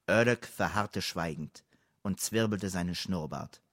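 usual speaking voice

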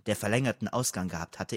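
usual speaking voice

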